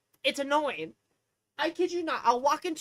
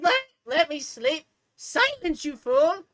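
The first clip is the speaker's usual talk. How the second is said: loud, high-pitched acted outburst; exasperated mock command; 5 semitones higher, 7 dB louder, slower than usual. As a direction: order your own brain to shut up, theatrically. Acted quarrel with own brain.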